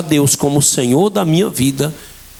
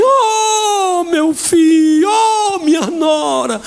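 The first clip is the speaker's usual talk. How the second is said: high, slow, tearful wail of joy; crying mother; 12 semitones higher, much slower than usual. Retold or imitated. Mother weeping for son and daughter-in-law, high tearful cry. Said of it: "minha mãe".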